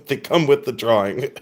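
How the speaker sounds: ordinary talk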